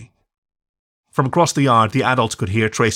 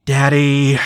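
exasperated; 4 semitones higher, 6 dB louder than usual. A narrator's reading. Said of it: "said Lucy with exasperation".

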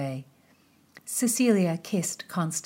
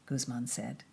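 plain reading voice

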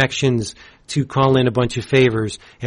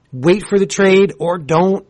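normal talking voice

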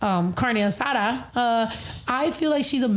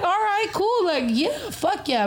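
higher, eager yes; mock eager agreement; 9 semitones higher than usual. Replying to the invite, eagerly higher. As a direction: jump at the offer, keen. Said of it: "I was like".

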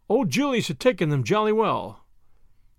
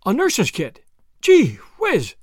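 higher, louder burst of amazement; astonished exclamation; 4 semitones higher, 6 dB louder, slower than usual. A narrator's reading. Julius interrupts on hearing of a nurse's kit, astonished exclamation. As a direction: exclaim it as if suddenly struck.

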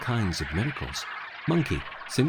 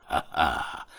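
low scornful demon ha-ha; demon laugh; 6 semitones lower than usual. A narrator's reading. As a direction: laugh down at the news. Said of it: "laughed the demon".

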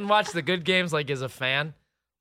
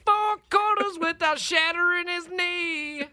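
much higher, mock-dramatic announcer; dramatic reality show hype; 12 semitones higher than usual. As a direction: hype it like a trashy TV promo. Mock hype of injury-prone QB.

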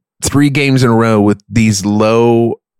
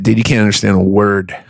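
normal talking voice

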